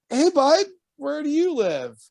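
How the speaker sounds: put-on high, coaxing voice for a toddler; high sing-song kid voice. To a toddler in a diaper on the sidewalk, high coaxing voice.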